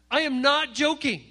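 usual speaking voice

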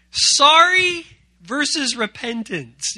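loud, slow announcement of sorry versus repentance; emphatic title. Shown as a demonstration. Youth pastor announcing the topic, slow and loud. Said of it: "announcing the lesson topic".

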